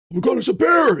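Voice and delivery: high, startled cry of fear; alarmed. Retold or imitated. Andrew spotting the bear on the trail, high alarmed cry. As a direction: gasp it out as you spot the bear. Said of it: "Andrew says".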